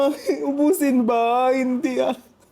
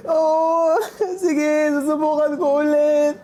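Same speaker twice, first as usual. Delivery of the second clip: ordinary delivery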